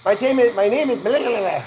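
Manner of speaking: imitating a friend speaking Spanish; mock Spanish accent. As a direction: rattle it off like a fast Spanish speaker. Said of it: "she sounds very Spanish".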